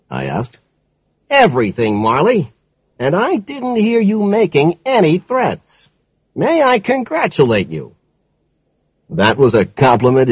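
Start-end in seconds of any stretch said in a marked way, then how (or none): 1.3-8.0 higher, approving captain; a hint of a pleased captain; police captain voice. A narrator's reading. Captain answers.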